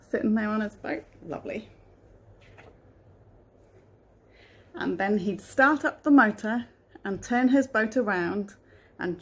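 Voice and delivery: storybook reading voice; reading to children. A narrator's reading. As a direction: read it gently to a child. Reads a picture book aloud.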